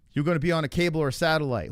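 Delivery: ordinary delivery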